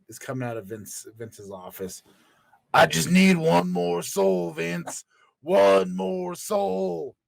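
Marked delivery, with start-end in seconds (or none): 2.7-7.1 slow, drawn-out Undertaker voice begging for souls; Undertaker impression. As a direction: drag out each word like a desperate undead wrestler. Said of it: "terrible impressions".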